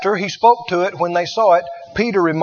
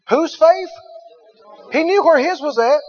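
normal talking voice

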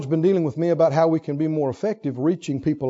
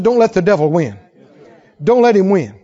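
Don't let the devil win, louder this time; repeated louder; 7 dB louder than usual. Acted. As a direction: say it again with force. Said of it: "I said".

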